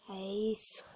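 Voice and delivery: soft unvoiced whisper of É isso. Real, not acted. Gamer whispers to himself after a win. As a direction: breathe it out to yourself. Quiet satisfaction.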